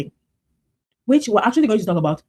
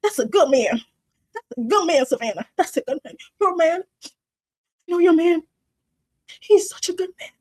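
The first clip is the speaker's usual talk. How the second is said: mock pushy pep talk; 10 semitones higher than usual. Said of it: "And it's like".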